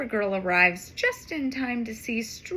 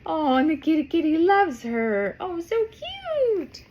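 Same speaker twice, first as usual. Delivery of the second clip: cooing; 4 semitones higher, 4 dB louder than usual. Real, not acted. Reader cooing over kitty picture.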